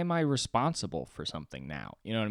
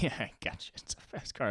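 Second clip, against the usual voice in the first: giggling cop; 5 semitones higher, 3 dB quieter than usual. Retold or imitated. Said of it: "at least giggle a little bit".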